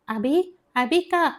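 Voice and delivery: high-pitched alarmed call. A narrator's reading. Devi calls out to weeping Abi, high and alarmed. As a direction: call the name in sudden worry, pitch jumping up. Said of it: "பதறிப்போன Devi".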